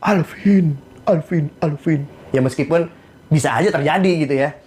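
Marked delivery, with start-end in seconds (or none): 0.0-2.1 mock heavenly voice, slowing as it calls. Voice from the sky calling Alvin, mock heavenly. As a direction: call the name as a pretend voice from the sky. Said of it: "keluar suara dari langit".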